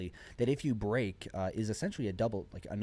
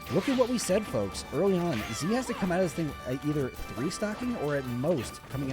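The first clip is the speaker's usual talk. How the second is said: esports caster; 5 semitones higher, 4 dB louder than usual. Real, not acted. Hyped live commentary.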